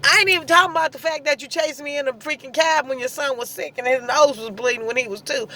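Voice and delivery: usual speaking voice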